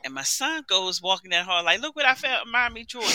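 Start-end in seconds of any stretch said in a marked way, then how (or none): 1.8-3.1 put-on higher, soft little-boy voice; lightly voiced child showing off a find; young son. Mother voices her young son.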